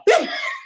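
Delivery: high burst of laughter with the head thrown back; big laugh, head thrown back. Shown as a demonstration. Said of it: "now watch this".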